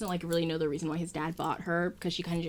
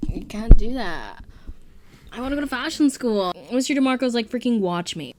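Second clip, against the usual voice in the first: Carmine; 4 semitones higher, 12 dB louder than usual. Retold or imitated. Son begging his dad for fashion school, loud protest. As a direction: raise pitch and volume in a hammed-up teenage plea. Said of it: "Carmine's like".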